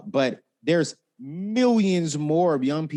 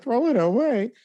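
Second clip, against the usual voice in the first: put-on much higher, louder child's wail; imitating upset nephew; 12 semitones higher, 4 dB louder than usual. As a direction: echo the kid's dismay. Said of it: "He was like".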